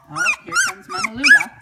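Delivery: high, loud excited squeal; very high and loud delighted cry. Real, not acted. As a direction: squeal it out as the mother dog comes in. Excited at mother dog arriving.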